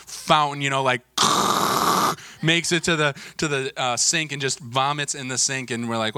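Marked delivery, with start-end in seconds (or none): 1.1-2.4 long noisy gush made with the mouth; imitated vomit spray. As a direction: mimic a fountain of puke.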